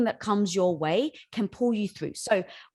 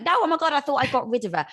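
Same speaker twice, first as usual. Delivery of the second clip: mock dismay; 5 semitones higher, 4 dB louder, faster than usual.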